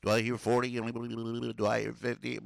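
loud auctioneer calling out bids. Pretend. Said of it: "I sound like an auctioneer".

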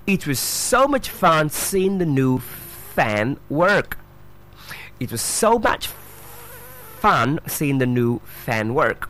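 "fun" with uh, "fan" with open a, drawn out; distinct pronunciation. Shown as a demonstration. Teacher showing two different words, slow distinct pronunciation. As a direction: slowly keep "fun" and "fan" clearly apart. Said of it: "So fun and fan, two different words".

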